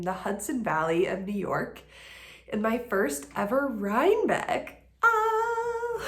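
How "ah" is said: high, bright burst of delight; excited squeal. Real, not acted. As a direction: squeal with sudden glee. Excited about first Rhinebeck.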